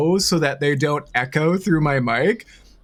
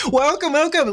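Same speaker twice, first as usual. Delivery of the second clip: excited laughing welcome; 10 semitones higher than usual. Real, not acted. Greeting raiders.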